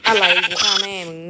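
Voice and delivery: loud, high, angry shouted threat; real rage, shouted loud and high; furious outburst. Real, not acted. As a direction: yell it at the game in real fury. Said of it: "แรงไปเปล่า".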